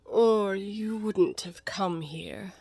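very slow, whispery cat drawl; Cheshire Cat. Acted. The cat explains why Alice is mad, languid. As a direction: spread the words out languidly.

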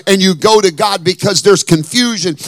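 loud, rising preacher's cry over music; full-force preaching. Acted.

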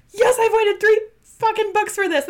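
loud, high-pitched excited yell; shouted triumphant excitement; thrilled cheer. Retold or imitated. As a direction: burst out elated, way up in pitch. Acts out first thrilled reaction.

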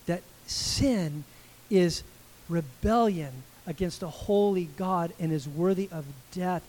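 ordinary talk, nothing marked

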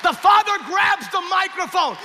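high-pitched, fervent narration; impassioned preaching. A narrator's reading. Preaches the climax at force.

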